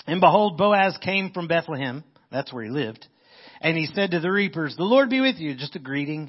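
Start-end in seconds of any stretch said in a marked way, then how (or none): 0.1-2.0 raised, ringing reading voice; preacher reading the Bible aloud; scripture reading. Acted. 4.8-5.6 higher, louder call across the field; hearty greeting. Acted. Voicing Boaz greeting reapers.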